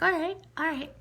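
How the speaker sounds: high-pitched retold acceptance; wary all right. Woman in Wonder Woman mode at a noise, wary. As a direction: pitch it up, sizing up the trouble.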